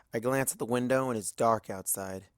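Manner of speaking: ordinary delivery, nothing marked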